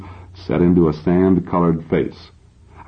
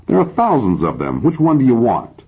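higher-pitched, mildly angry comeback; exasperated retort; 7 semitones higher than usual. A narrator's reading. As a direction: throw it back in exasperation, pitch jumping up. Badgered patient snaps back at therapist.

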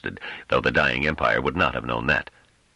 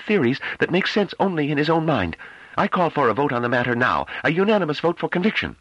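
tense, tightly controlled, high. A narrator's reading. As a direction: hold the fury barely in check. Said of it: "a tense but tightly controlled voice".

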